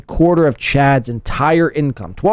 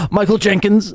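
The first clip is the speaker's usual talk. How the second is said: laughing disbelief; 8 semitones higher, slower than usual. Real, not acted. Laughing at misread name.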